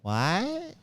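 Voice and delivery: high rising, drawn-out question; baffled cashier. Retold or imitated. Cashier faced with a stranger's fish, baffled high question. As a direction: imitate a baffled clerk, pitch sliding way up. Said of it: "The woman goes".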